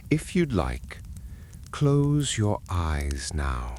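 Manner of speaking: plain reading voice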